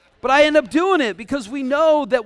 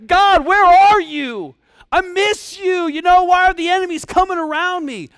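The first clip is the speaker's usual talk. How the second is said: plaintive King David; 9 semitones higher, 8 dB louder than usual. Retold or imitated. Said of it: "he's like".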